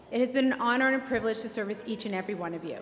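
ordinary delivery

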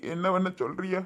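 helpless question through tears; tearful. Acted. Elderly man asking his in-law what he can do, tearful. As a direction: choke up as you ask, helplessly. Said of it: "அழுவாதியே".

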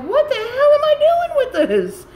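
high, loud exasperated outburst; exasperated self-quote. Retold or imitated. Retells own exasperation at bath bomb.

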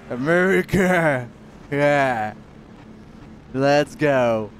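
slow, loud stretched-out America then a loud yell; excited cheer. Real, not acted. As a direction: bellow it like a proud race fan. Race-fan cheer in English. (streamer qui hurle America en pleine course)

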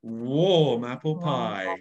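slow, warm, resonant delivery. Shown as a demonstration. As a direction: savour every word, warm and slow. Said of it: "would you like to say some warm apple pie".

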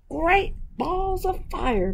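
high, slow goose exclamation; goose's cry. Acted. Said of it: "cried the goose".

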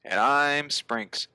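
normal talking voice

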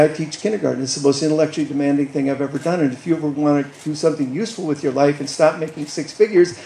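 fast, crammed run-on answer; rushed till out of breath. Retold or imitated. Teacher firing a long comeback at a lawyer, rushed and breathless. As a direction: race through it until the breath runs out. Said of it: "and then I'd run out of breath".

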